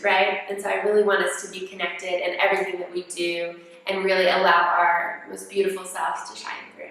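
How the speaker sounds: ordinary talk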